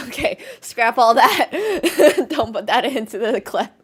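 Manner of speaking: loud, through bursts of laughter; cracking up while trying to speak; helpless giggles breaking up the words; laughing. Real, not acted. Cracking up at own joke.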